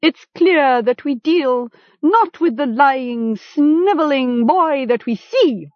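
high-pitched, sarcastic respect; mock deference. A narrator's reading. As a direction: address the captive with false esteem. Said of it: "speaking now in mock deference".

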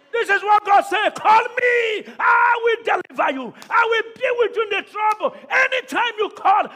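high, loud shouted proclamation; sustained high preaching shout; preaching at full force. Acted. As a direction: thunder God's promise at them. Preaching climax voicing God.

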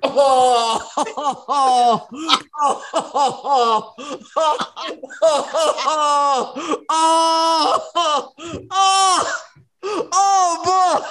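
long high laughing "oh"s; helpless laughter. Real, not acted. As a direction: crack up and wail through it. Cracking up at own story.

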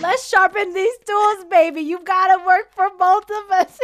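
high, loud, whooping hype; loud playful egging-on; excited teasing cheer. Real, not acted. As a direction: cheer them on at full volume, joking. Joking cheer at friend's son's manifesting.

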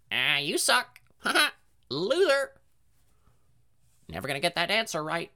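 high, mocking parrot squawk; taunting parrot. Parrot taunting the kids over a wrong answer, high mock squawk. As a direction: jeer like a cheeky bird. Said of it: "It would be like".

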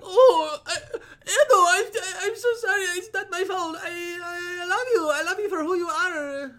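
high, teary mock-sobbing mom voice; awful mom impersonation. Son mimicking his mom apologizing for his face, hammed-up crying. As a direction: ham up a tearful apologizing mother, half laughing. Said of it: "That was an awful impersonation of my mom".

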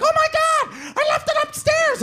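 very high squealed alarm; hammed-up frantic kid voice; mock panic. Mark panicking about the camera.

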